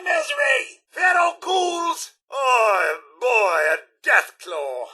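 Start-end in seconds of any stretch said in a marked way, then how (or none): 2.3-4.9 low, dismayed groan of recognition; sinking dread. Acted. Butler spots a deathclaw, low and dismayed. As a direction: let the heart sink on seeing it.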